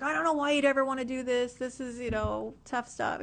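lightly imitating Matt in an Australian accent; Australian twang, done as a loose impression; mock Australian accent. Mimicking her boss Matt, light Australian accent. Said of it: "he's like".

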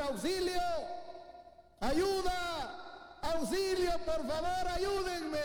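high mock cries for help; crying for help. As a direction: yell for help like a drowning man, pitched high. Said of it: "empezó a gritar".

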